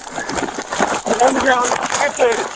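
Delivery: shouted surrender demands. Real, not acted. Police order fleeing suspect down.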